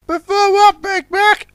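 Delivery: very high curious train voice; little train Puffer. Acted. Puffer asks Big Mac, high and curious. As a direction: ask in the chirpy engine voice.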